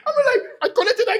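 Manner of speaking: fast, high, laughing rush; giddy retelling. Real, not acted. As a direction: tumble through it laughing. Laughing, rushing story.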